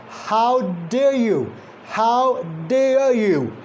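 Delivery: slow "How dare you", "dare" as "deh-uh". Shown as a demonstration. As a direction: draw out "How dare you" to show the glide in "dare". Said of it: "There is no ra".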